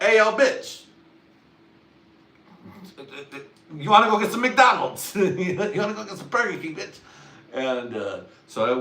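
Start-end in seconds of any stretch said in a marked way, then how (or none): 0.0-7.0 high, loud holler from a distance; calling out loud. Retold or imitated. Hollering at a girl from afar, loud and high. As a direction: yell it across the street. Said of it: "It's just like".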